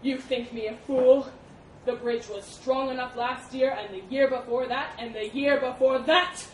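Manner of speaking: loud, furious elephant tirade; spat accusations. A narrator's reading. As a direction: hammer the boar with mounting accusations. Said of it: "spat the elephant".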